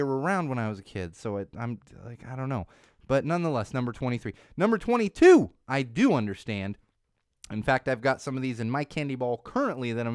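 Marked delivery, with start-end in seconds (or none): 4.6-5.5 announcer callout. Acted. Countdown host calling out number.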